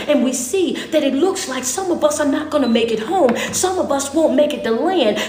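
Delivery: preacher voicing the crew's disbelief; impassioned preaching. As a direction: plead as the men on the boat. Voicing frightened crew on boat.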